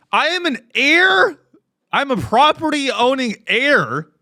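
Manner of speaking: a hint of a pompous finance bro; mock entitled rich guy. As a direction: puff up like a privileged heir. Mock privileged persona boast.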